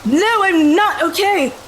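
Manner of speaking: high, loud, slow wail of protest; indignant high-pitched yell; upset, cracking outburst. Acted. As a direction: cry out in acted distress, drawing it out. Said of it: "and my voice cracks".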